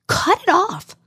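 high, excited mock-announcer cry of cut it off; mock urging. Host urges listeners to cut mattress tags, in a high mock-excited cry. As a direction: urge the listeners, hammed up and high. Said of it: "Okay, tonight".

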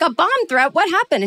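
high-pitched shocked questions; mock-alarmed reaction to bad news; acted alarm. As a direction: blurt it high in disbelief. Acts own alarm at bomb news.

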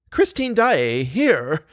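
Richard echoes "here" high and startled; surprised echo. A narrator's reading. Manager echoing the commissary, startled. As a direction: echo the question back in disbelief. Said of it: "echoed Richard".

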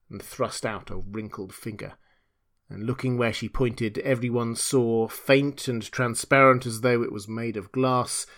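ordinary delivery

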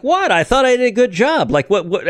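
high, confused what. Retold or imitated. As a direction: act baffled at the reaction. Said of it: "I'm like".

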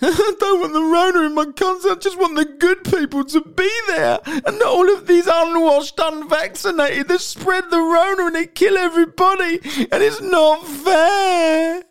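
very high, whining fake sob; mock crying. Mocking the singer's vaccine rule, fake crying. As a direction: parody a tearful tantrum, wailing the last words. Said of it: "Why are you crying".

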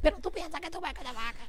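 high, hushed Spanish nagging imitation; mock scolding girlfriend. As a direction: softly mimic her rapid Spanish telling-off. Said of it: "they be like".

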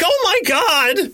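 high, slow mock Luigi cry. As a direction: squeak it out like a panicked Luigi. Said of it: "Luigi being like".

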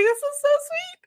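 very high, thin squeal of delight; squeaky gush. Retold or imitated. Host retelling her own reaction, very high squeal. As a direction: squeak it like you're overcome. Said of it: "I was like".

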